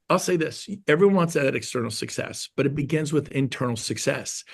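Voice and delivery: ordinary talk, nothing marked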